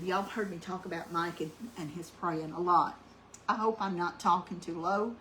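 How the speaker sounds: ordinary talk, nothing marked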